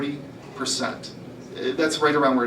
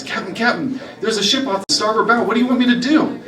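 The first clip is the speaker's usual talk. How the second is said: put-on higher, rising alarm from the young pirate; urgent new recruit; 8 semitones higher, 4 dB louder than usual. New recruit alerting the pirate captain, urgent call.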